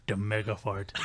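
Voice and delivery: low, imitated country deadpan; deep country coworker. Imitating the coworker repeating his answer, deep country voice. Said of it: "he had a real deep down low voice".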